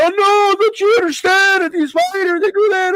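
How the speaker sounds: mock the whiny critics in a high voice; sarcastic impression of an upset fan; mock pity party. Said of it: "throw a pity party and be like".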